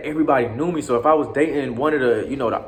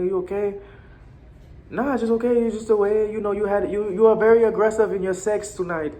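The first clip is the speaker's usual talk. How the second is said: higher girlfriend voice, hint of accent; girlfriend's voice; 8 semitones higher than usual. Girlfriend telling him he was rough tonight, higher voice. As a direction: play the Colombian girlfriend, up high. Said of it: "she still had a Colombian accent".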